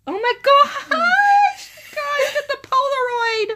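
high, loud squeal of delight; real excited squeal. Real, not acted. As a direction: shriek with thrilled surprise, stretching it out. Delighted at finding Polaroid in box.

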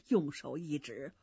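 ordinary delivery, nothing marked

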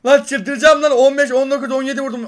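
high, loud exasperated yelling; frustrated shout. Real, not acted. Gamer shouting in frustration over weak shots. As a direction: rant about the hits at full voice.

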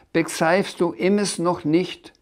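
hoch, langsam und lauter; Drache mit Sprachfehler. A narrator's reading. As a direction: als kleiner Drache gedehnt nachhaken. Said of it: "sagte der Drache". (hohe, langsame Drachenstimme)

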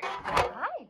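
high-pitched hello from a little dog; lightly voiced eager little dog; panting little dog. A narrator's reading. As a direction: greet like a small panting dog. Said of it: "panted the little dog".

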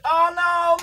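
loud alarmed cry; shouting. Real, not acted. Alarmed reaction to a wrestling move.

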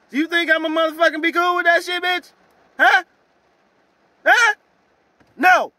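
high, fast, furious challenge; heated rant. Real, not acted. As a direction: fire the question at her. Ranting at coworker.

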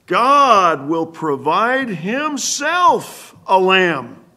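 slow, loud, high proclamation, each word drawn out; preaching shout. Real, not acted. Preacher to the congregation, declaring God provides the lamb, slow and loud. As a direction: proclaim it slowly at full force. Preacher thunders the key verse.

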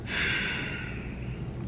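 long, soft exhaled sigh; real weary sigh. Real, not acted. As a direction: let out a long, airy breath. Frustrated pause mid-rant.

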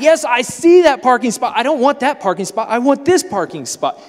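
high, loud annoyed snap; irritated retort. Retold or imitated. Parent snapping at spouse in the church lot. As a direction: snap back about the parking spot.

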